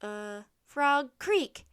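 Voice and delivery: drawn-out uh, then loud answer; hesitate, then blurt it out. A narrator's reading. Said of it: "Jack blurted out".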